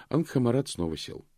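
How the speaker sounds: usual speaking voice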